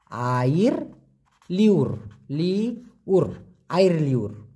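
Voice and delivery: আইর and লিওর split, লিউর stretched very slowly; careful pronunciation. Shown as a demonstration. Teacher carefully modelling air liur for the class. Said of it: "দিয়েই দি, ভালো করেই দি".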